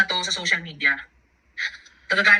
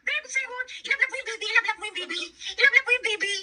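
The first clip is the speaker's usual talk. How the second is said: high-pitched cooing; 12 semitones higher than usual. Real, not acted. Talking to the baby, high baby talk. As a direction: coo very high, as to an infant.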